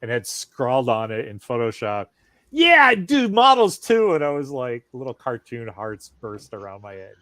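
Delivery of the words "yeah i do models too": thrilled. Retold or imitated. Retelling a thrilled reaction.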